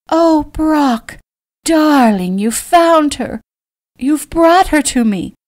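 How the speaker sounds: high, loud, gushing mother; high-pitched elated greeting with drawn-out words; delighted calling out. A narrator's reading. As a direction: call out to your son, overjoyed. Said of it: "the lovely woman called".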